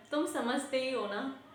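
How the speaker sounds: ordinary delivery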